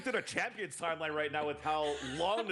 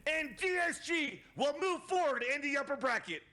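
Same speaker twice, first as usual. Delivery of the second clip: shouted play-by-play; 12 semitones higher than usual. Acted. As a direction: belt out the match-winning call. Caster calls the winning round.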